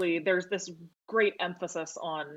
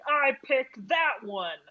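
slow, higher mock swoon of a man choosing a woman; lightly hammed-up smitten guy; mock romantic declaration from across the room; imitating a guy in a club; 6 semitones higher, 4 dB louder, much slower than usual. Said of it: "he's gonna be like".

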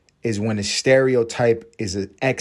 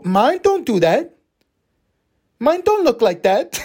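high-pitched, incredulous viewer; high comic yelp of disbelief; mock dismay; 13 semitones higher, 4 dB louder than usual. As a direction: act out a man baffled by what he sees. Comic acts shocked porn viewer.

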